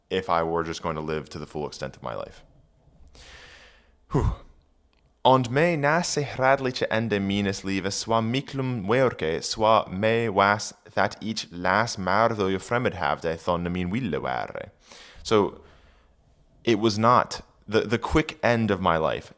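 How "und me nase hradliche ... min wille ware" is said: put-on reading Old English aloud; careful Old English recitation. Shown as a demonstration. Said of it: "reading Old English aloud".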